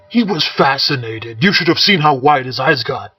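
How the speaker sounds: gruff warrior voice, arguing. Acted. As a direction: argue the point heartily. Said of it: "the gruff warrior argued".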